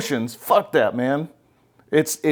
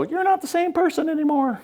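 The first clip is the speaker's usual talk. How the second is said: much higher mock wife voice; imitating the wife. The coach imitates a wife complaining to her husband in a high mock voice. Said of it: "she starts to go".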